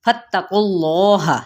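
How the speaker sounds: फत्तकुल्लाह chanted slowly with the त doubled; slow recited demo. Shown as a demonstration. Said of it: "फा को ज़बर को ता के साथ मिलाएंगे". (धीमी तिलावत में)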